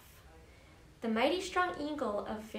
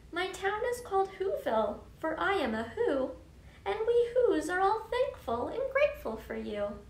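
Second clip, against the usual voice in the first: higher, lively mayor voice; grateful little mayor; 8 semitones higher than usual. Acted. Mayor telling Horton about Whoville and the Whos, grateful and lively. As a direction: proudly introduce your town in a lifted voice.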